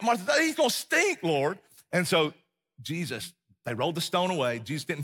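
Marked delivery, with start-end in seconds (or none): 0.0-1.6 high sing-song worry; worried protest. Retold or imitated. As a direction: fret it up high. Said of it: "Mary's like".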